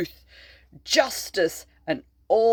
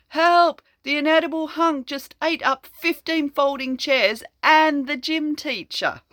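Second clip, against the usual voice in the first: higher, hammed-up call for help; a kid yelling for help, pitched up; mock panicked cry; 9 semitones higher, 3 dB louder than usual. Reading to a child, a frightened pupil crying for help. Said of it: "panicked kid calls out".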